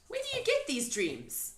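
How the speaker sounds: put-on high, light girlish voice; little girl voice. A narrator's reading. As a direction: play young Sophie, kind.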